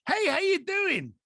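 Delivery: high, slow, overly chirpy greeting; fake friendly hello. Stranger gushing a greeting at you. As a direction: sing out the hello like a stranger who wants something. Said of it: "they've gone".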